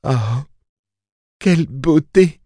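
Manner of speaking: high, adoring purr. A narrator's reading. Mia admires her newborn baby, purring. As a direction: coo it high with wonder. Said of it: "ronronna Mia".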